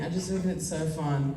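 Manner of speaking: ordinary talk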